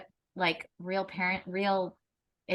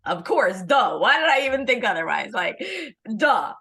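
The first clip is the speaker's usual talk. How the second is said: louder, hammed-up duh; mock self-scolding mom; 6 dB louder than usual. Mother answering her son, a loud hammed-up duh. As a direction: play up the silly mom. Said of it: "And I'll be like".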